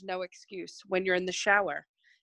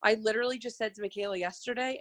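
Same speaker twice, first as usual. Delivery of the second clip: ordinary delivery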